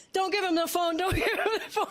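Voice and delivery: pleading through nervous laughter; half-laughing please don't. Retold or imitated. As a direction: beg while giggling.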